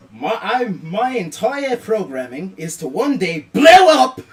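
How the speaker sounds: loud, laughing, slow robot bragging; excited robot boast. Acted. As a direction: build up to the big boom, giggling. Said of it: "and it's just like".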